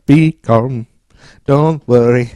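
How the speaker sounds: loud, put-on singer impression. Co-host recasting alien as famous singer, loud impression. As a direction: belt out a soothing singer's catchphrase in his accent. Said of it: "Let's do [name]".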